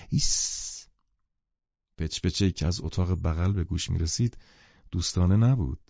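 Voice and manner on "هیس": warning hush. Acted. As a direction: shush them in warning. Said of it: "با حالتی هشدار دهنده گفت".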